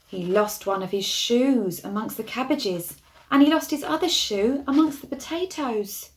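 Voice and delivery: ordinary delivery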